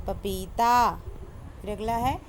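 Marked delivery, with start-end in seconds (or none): none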